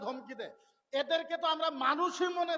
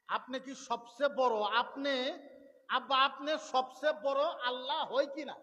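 normal talking voice

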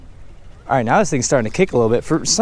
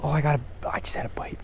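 sudden excited whisper; whispering in excitement at a bite; breathless whisper; 11 dB quieter, faster than usual. Real, not acted. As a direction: hiss out the news of a bite.

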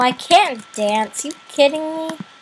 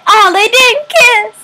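higher, louder outraged exclaim; 1 indignant surprise; 6 semitones higher, 5 dB louder than usual. Real, not acted. Gamer outraged the characters never kissed, higher and louder. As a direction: cry out in disbelief. Disappointed characters didn't kiss.

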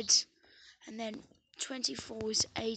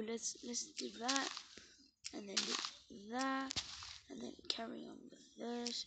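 normal talking voice

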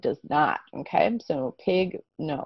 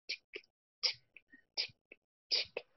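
near-silent clicked tick; clock tick; 21 dB quieter than usual. Shown as a demonstration. Teacher imitating a ticking clock. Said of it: "listen to a clock tick".

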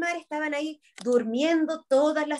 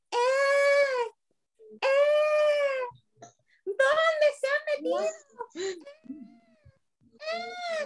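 very high, stretched-out calls; searching little sister; 12 semitones higher, 3 dB quieter, much slower than usual. A narrator's reading. Little E searching for her sisters, high plaintive calling. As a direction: call plaintively in a tiny high voice.

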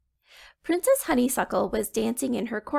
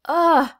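disgusted ugh; 6 semitones higher, 8 dB louder than usual. Acted. Princess hits stink cloud.

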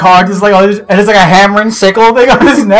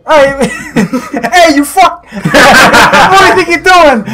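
put-on high, laughing Boston accent; hammed-up Boston guy picking a fight; mock-indignant Boston voice, breaking into laughter; Joey Wheeler; 7 semitones higher, slower than usual. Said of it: "a Boston version of Joey Wheeler".

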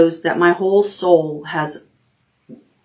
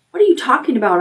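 chirp; 8 semitones higher, much faster than usual. Acted. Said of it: "I chirp".

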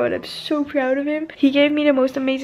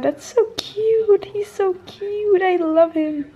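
gushing; 6 semitones higher, slower than usual. Real, not acted. Gushing over Shawn's video.

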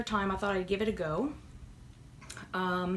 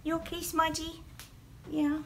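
soft pet voice; 8 semitones higher, 4 dB quieter than usual. Real, not acted. Checking on the cat.

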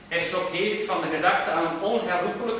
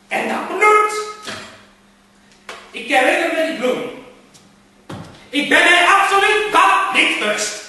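high, loud, agitated outburst; frantic raving; 10 semitones higher, 8 dB louder than usual. Acted. Gentleman shouting at himself over the flower, frantic. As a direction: rave at the ground in a panic.

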